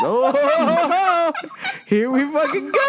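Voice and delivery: high, laughing exclamation. Retold or imitated. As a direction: laugh through the words in mock dread. Laughing dread at customer's next move.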